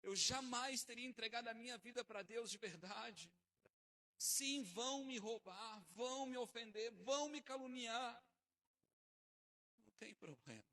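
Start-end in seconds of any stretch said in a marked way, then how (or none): 0.0-8.2 fervent Pentecostal preacher shouting the point; full-force preaching. Acted. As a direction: declare it to the whole church.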